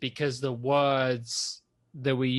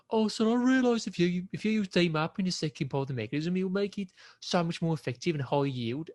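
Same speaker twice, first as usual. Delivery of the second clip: mimicking a Brummie research student; Brummie accent; 4 semitones higher than usual. Imitating classmates in the research group, Brummie accent. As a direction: put on a lilting, higher Birmingham accent. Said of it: "with the accent, like".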